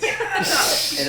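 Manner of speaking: put-on high, loud kid cheer; triumphant. Kobold standing over the pinned bear, triumphant yell. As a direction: crow it like a winning little kobold. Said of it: "stands up triumphant and says".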